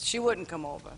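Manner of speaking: low, softly voiced old man; older gentleman impression. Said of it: "I'm doing my impression".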